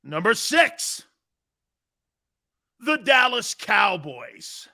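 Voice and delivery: slow, drawn-out ranking reveal; countdown announcer. Acted. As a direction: build suspense on each number.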